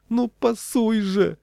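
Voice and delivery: very high, drawn-out wail; suffering boy. A narrator's reading. Boy still begging for the ball, wailing plea. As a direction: stretch the plea out desperately. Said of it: "страдал тоненький".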